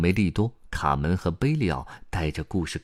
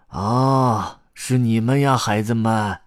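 put-on loud, higher old-man exclamation; raised voice of a flustered grandpa; startled recognition; 6 semitones higher, 8 dB louder, slower than usual. A narrator's reading. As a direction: cry out on spotting the children. Crying grandpa startled by children.

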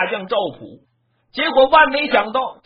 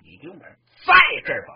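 mock battle shout; 4 dB louder, faster than usual. General swings his hammer.

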